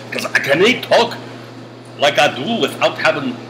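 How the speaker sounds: put-on botched Scottish accent, lilting upward; horrible Scottish accent. Teacher blaming a drained iced tea, bad Scottish accent. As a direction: attempt a Scottish accent and do it badly. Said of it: "that Scottish accent was horrible".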